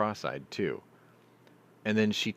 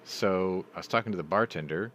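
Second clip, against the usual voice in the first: ordinary talk, nothing marked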